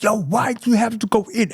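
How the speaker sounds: mimicking the brother's pushy challenge, high; imitating brother. Narrator voicing his brother, pitched high and pushy. Brother quoted, acting him out.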